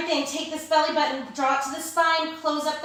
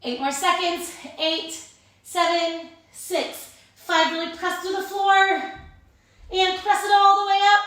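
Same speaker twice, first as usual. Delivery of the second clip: loud coaching; 7 semitones higher, 5 dB louder than usual. Real, not acted. Final hold, urging the class.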